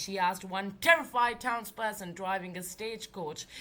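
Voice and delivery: plain reading voice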